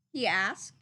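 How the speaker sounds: ordinary delivery, nothing marked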